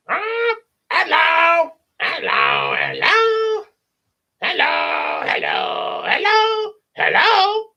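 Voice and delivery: loud screechy parrot, pitch leaping high; Gilbert Godfrey. Acted. Parrot greets the children, loud high comedian's squawk. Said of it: "Gilbert Godfrey for the parrot".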